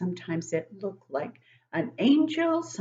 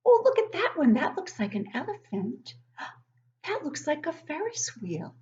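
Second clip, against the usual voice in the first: put-on childlike wonder; 5 semitones higher than usual. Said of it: "I remember as a child looking down and seeing".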